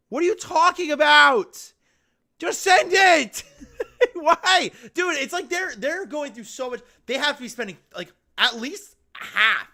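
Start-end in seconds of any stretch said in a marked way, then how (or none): 0.1-4.7 loud, high, disbelieving outburst; real frustrated yelling; exasperated shout. Real, not acted. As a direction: yell it at the screen in disbelief. Exasperated at refusal email.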